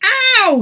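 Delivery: high, loud yelp; pained ow. A narrator's reading. Emma stood on by Errol, yelp of pain. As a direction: yelp under his weight. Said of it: "said Emma".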